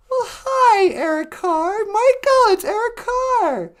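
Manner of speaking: high, loud starstruck hello; thrilled greeting. Acted. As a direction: greet the star caller in delight. Host thrilled at star caller.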